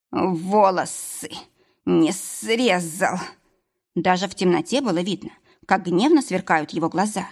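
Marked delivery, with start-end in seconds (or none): 0.1-3.2 медленный сиплый хрип, без дыхания; выдавить слова с хрипом после удара; хрипло. Acted. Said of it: "он прохрипел". (хриплый шёпот, задыхаясь)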